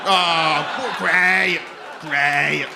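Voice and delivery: loud mock-exasperated praise; big, grudging fake enthusiasm; sarcastic praise. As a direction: bellow your praise as if it ruins the joke. Mock praise of cancer worker's job.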